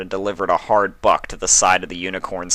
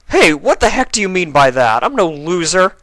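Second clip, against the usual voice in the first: high, loud indignant yell; shouting; 11 semitones higher, 7 dB louder than usual. A narrator's reading. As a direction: shout back at the insult. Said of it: "Spike shouted at him".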